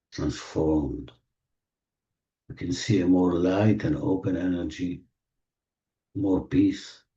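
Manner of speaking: slow, gentle meditation voice; guided meditation. Acted. Meditation leader guiding the group through healing, soothing. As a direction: ease them into the feeling, unhurried.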